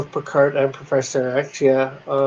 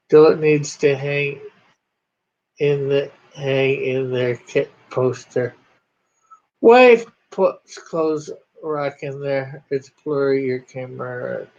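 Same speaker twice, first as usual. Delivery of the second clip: ordinary talk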